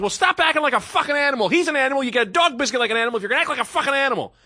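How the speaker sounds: high, loud scolding yell; furious rant. Retold or imitated. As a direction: berate the lady at the top of your voice. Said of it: "I was like".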